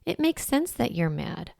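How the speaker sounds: put-on calm the angry child softly; soothing validation. Shown as a demonstration. Parent validating a mad child, soft and gentle. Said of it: "I love to say".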